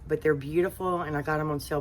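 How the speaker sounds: normal talking voice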